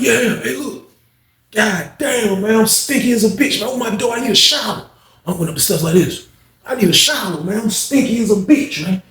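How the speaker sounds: put-on high, showy voice of a returning old-timer; voicing Unc. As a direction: strut in announcing you stink and need a shower. Unc's entrance voice.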